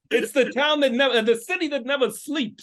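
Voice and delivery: a playful New Yorker boast; New York accent. Pretend. Guest finishing his New York accent bit. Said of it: "give me a little New York".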